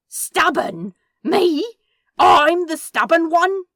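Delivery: can't believe what he just heard; incredulous outburst. Acted. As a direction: demon outraged at being called stubborn. Incredulous shout in the quarrel.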